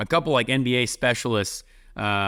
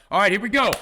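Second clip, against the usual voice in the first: mock game-start call; 7 semitones higher, 3 dB louder, faster than usual. Players starting practice on cold latecomer, mock rallying call. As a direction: imitate a higher, eager kickoff shout.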